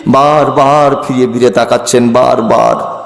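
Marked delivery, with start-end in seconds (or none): none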